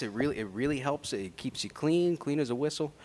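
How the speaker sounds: soft smooth ad read; commercial voice. Acted. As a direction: sell it like a TV ad. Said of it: "Y'all hear his commercial voice".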